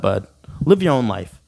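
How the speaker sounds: ordinary talk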